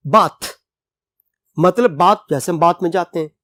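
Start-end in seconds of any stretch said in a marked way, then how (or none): 0.1-0.5 "बाथ" said with a short a and a hard t, like bath; pron as bath. Shown as a demonstration. Teacher showing that b-a-t-h is read "बाथ". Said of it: "बी ए टी एच".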